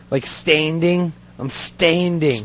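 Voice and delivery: slow "standing" with the a shifted toward e; Aussie vowel in standing. Shown as a demonstration. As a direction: stretch "standing", a sliding toward e, Aussie style. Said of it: "A's to E's".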